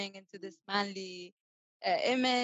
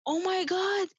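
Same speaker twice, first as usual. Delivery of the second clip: high, loud oh my God; a fan hearing she's the host, amazed; amazed gasp; 10 semitones higher, 4 dB louder than usual. Retold or imitated. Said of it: "He's like".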